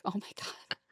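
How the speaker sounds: retold near-whispered exclamation; hushed dismay. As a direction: say it voicelessly, as her stunned reaction. Retold freaked-out girlfriend's reaction.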